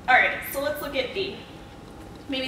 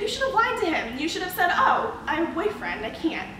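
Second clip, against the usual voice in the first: coworker's scolding; 7 semitones higher than usual. Retold or imitated. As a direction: imitate the coworker insisting you should have lied. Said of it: "She said".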